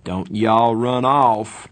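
slow, loud cheery call; cheerful. A narrator's reading. Two-Bit leaving his friends for the drugstore, cheerful. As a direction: draw it out jokingly on the way out. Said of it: "Two-Bit said cheerfully".

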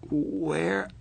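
rough, scratchy single word; hoarse. A narrator's reading. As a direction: croak it out hoarsely. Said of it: "I said hoarsely".

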